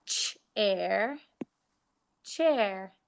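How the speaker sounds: "ch-, air" split into its sounds, then "chair" blended; sounding out. Shown as a demonstration. Teacher sounding out words for pupils, segmenting. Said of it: "We have".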